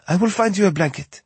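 put-on high young woman with French accent; worried Parisian woman, French accent. French vet assistant voiced.

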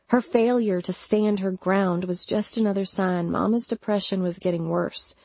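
ordinary delivery

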